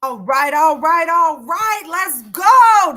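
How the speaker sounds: building hype call to the audience; hype host. Real, not acted. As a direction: pump up the room. Host hyping the crowd.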